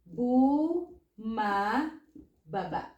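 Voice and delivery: slow "bu... ma... baba" with pauses; teaching breakdown of bu, ma, ba, ba; syllable by syllable. Shown as a demonstration. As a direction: stretch bu and ma apart, then finish on baba. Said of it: "Then it will become".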